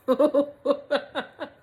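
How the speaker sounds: loud, high burst of laughter; high, helpless cackle; real laughter. Real, not acted. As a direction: crack up loudly at your own story. Cracks up at the description.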